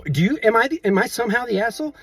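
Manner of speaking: high-pitched, disbelieving question; incredulous appeal. Real, not acted. Asking viewers to judge the quarrel, incredulous. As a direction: ask as if the very idea is absurd.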